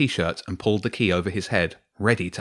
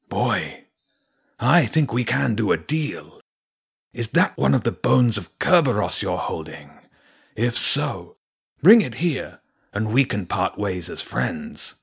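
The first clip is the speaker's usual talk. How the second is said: wolf villain coaxing with swooping emphasis; Garor Ulf; 2 semitones higher than usual. Acted. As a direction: offer a deal with menacing smoothness. Voices the wolf making an offer.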